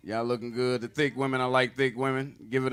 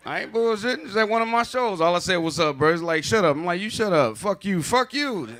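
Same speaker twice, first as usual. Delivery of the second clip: louder and higher, worked up retelling a scuffle; loud and keyed up; heated retelling; 5 semitones higher, 7 dB louder than usual. Retold or imitated. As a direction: fire it back like the fight is happening now. Heated story of a fight.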